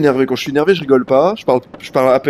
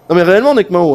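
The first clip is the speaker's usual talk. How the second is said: ordinary talk, nothing marked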